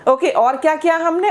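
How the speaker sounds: normal talking voice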